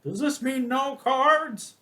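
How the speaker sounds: high, drawn-out puzzled question; old guy. Retold or imitated. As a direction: play the old guy, slow and high-pitched. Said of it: "the old guy's like".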